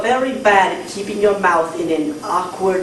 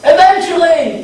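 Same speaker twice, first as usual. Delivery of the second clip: high shouted answer; 8 semitones higher, 5 dB louder than usual. Pretend. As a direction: yell it back at the leader. Protest chant response.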